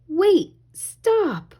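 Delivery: high urgent call; shouting. A narrator's reading. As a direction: cry out to stop someone. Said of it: "Ariel shouted".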